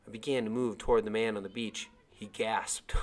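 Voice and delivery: plain reading voice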